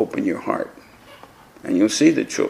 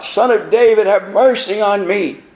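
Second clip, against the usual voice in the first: much higher, a loud plea; loud, higher beggar's plea; mock scream; 9 semitones higher, 3 dB louder than usual. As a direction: cry out to be heard over the crowd. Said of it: "And he screamed out".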